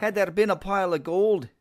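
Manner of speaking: high, hammed-up Scottish accent. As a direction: roll it out like a Scot dreaming of gold. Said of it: "I did that in a Scottish accent".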